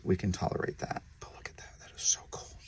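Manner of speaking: soft whisper of delight; hushed whisper. Real, not acted. Breeder marvelling at a python, hushed whisper. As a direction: whisper so you don't startle it. Admiring snake up close.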